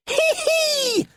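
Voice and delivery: very high shrieking creature giggle. A narrator's reading. Red creature taunting Finn out of reach, shrill high giggle. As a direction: tease with a piercing high hee hee. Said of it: "it laughed".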